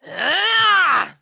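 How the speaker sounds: sharp high yell as he attacks; battle cry. Acted. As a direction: let out a fierce attack cry.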